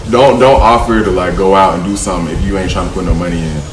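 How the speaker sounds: ordinary delivery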